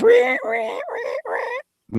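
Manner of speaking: squeaky stab sound effect; shrill stabbing violin squeal; high squeal sound. Shown as a demonstration. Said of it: "like a soundbite that goes".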